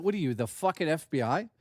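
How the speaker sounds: usual speaking voice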